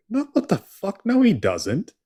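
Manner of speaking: high-pitched retold disbelief; acting out flat-out refusal to believe it; incredulous. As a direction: object in mock outrage, pitch leaping up. Acting out own disbelief at a fact.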